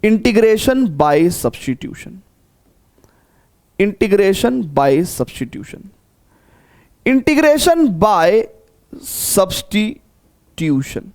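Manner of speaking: slow, spaced dictation for note-taking; teacher dictating the topic to students, slow and spaced. Shown as a demonstration. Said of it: "सभी लोग topic लिखेंगे".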